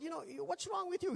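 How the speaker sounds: a light retold scold; mock rebuke. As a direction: poke fun at a friend.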